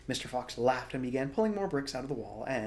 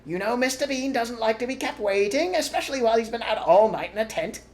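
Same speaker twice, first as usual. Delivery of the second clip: higher, louder call from afar; calling from upstairs; 12 semitones higher, 11 dB louder than usual. A narrator's reading. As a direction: call down the stairs. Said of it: "the voice called".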